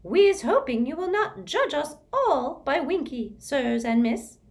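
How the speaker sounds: high, loud elf voice; an ashamed little elf apologizing; squeaky elf. Acted. Elf apologizing to the visitors, squeaky.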